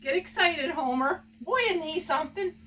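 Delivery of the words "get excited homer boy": ordinary talk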